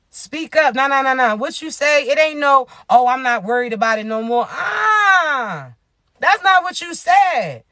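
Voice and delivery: acted confrontation. Host calling out someone who changed their words, acted confrontation. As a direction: call them out, pitch jumping up.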